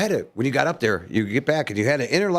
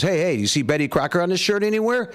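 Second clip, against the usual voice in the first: higher-pitched mock indignation for a punchline; indignant comeback; 7 semitones higher than usual. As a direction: throw the husband's line back at him as the wife. Wife throws catchphrase back, punchline.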